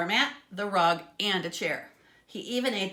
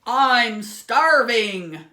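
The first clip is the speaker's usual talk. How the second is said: very loud, very slow monster pig bellow; giant pig shout; 4 semitones higher, 13 dB louder, much slower than usual. A narrator's reading. Giant pig demanding food, slow roaring bellow. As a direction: bellow your hunger like a giant beast. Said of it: "the giant pig shouted".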